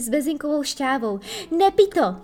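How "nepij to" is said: sudden loud, high shout; alarmed cry. A narrator's reading. Fairy stopping Peter from drinking, alarmed cry. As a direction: cry out a warning. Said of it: "vykřikla drátenička".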